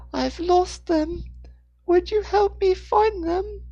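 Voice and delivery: put-on very high, slow child plea; little boy. A narrator's reading. Lost boy asking a stranger for help, little boy pleading. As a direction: plead slowly in a small boy's high pitch.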